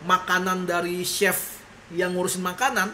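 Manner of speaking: normal talking voice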